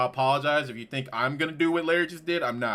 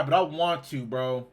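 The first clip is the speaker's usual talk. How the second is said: ordinary delivery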